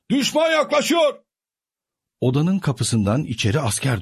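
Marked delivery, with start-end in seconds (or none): 0.1-1.2 high distant alarm cry; soldiers shouting. A narrator's reading. Soldiers outside warn of the enemy, a high distant cry. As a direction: yell the warning from outside. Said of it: "askerlerin bağırma seslerini".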